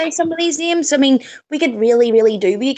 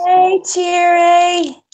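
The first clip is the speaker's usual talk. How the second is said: put-on slow baby talk; 6 semitones higher, 3 dB louder, much slower than usual. Greeting toddler slowly.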